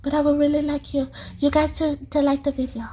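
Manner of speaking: put-on lightly high little-girl voice; voicing Cleo. Speaker voicing Cleo asking viewers to like, lightly high. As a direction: ask sweetly like a shy child. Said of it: "She's like".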